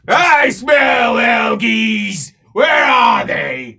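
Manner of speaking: loud, high, slowing accusing bellow; dwarf accusing the elves of tricks; suspicious dwarf shout. Acted.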